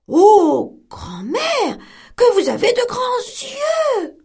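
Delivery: put-on girl staring at granny in bed; wondering little girl. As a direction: marvel like a puzzled little girl. Girl astonished.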